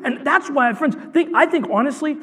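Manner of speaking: high, fervent preacher's voice; impassioned preaching. Real, not acted. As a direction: drive the point home with high-pitched urgency.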